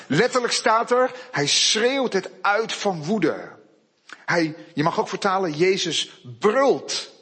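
fervent preaching rising in pitch; preaching with force. Real, not acted. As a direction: drive the point home with fervour. Preacher builds to fervour.

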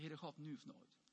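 low, soft intercession; acted prayer plea. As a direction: pray it quietly and gravely, voice held down. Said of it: "maar die roepen". (zacht, ingehouden smeekgebed)

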